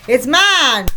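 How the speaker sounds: put-on high, squabbling child voices; children quarreling. The twins fighting over a sock, squabbling.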